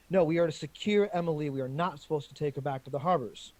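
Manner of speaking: firm angry protest. Acted. Man's voice protesting firmly.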